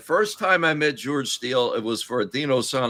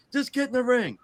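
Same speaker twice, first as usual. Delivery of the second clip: higher, animated retold instructions; playful higher retelling; retelling own words; 10 semitones higher than usual. Telling George the plan, higher animated voice. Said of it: "I said".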